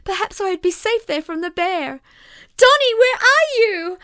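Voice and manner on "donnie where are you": high, loud panicked yell; frightened child yelling into the dusk; terrified yell. A narrator's reading. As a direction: call out lost and terrified. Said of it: "I yelled, still terror-stricken".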